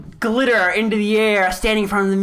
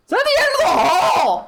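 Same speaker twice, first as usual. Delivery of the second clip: high shrill yelling wizard; shrieking wizard; 11 semitones higher, 3 dB louder than usual. Acted. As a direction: screech like a panicked wizard. NPC Cyprus yelling.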